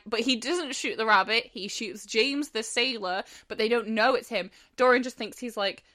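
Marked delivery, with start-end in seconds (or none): none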